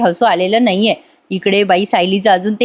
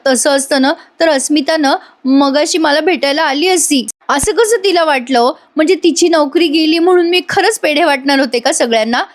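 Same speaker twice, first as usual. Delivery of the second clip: high, aggrieved acted mother; hurt complaint; 6 semitones higher than usual. Mother ignored by daughter tells husband, hurt. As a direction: plead your case, wounded, pitch raised.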